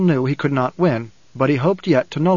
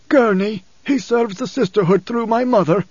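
high-pitched, agitated father's voice; troubled old father, pitched well up; Paul, alarmed; 7 semitones higher than usual. A narrator's reading. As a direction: protest in a strained, higher voice. Narrator voices Paul protesting.

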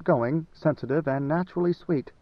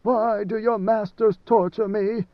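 higher and slow, pained plea; despairing moan; 6 semitones higher, slower than usual. A narrator's reading. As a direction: let the despair ache through it. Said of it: "he moaned in despair".

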